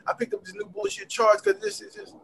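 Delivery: high-pitched imitation of an aggrieved client; mad client. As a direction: whine the complaint like a frustrated client. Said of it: "they're mad at you".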